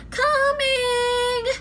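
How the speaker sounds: high, sung-out answer; calling back. A narrator's reading. Annie answering Mom from the window, called out.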